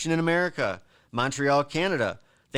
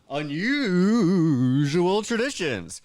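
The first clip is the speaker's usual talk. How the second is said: announcer voice; 5 semitones higher, much slower than usual. Acted. Announcing list segment.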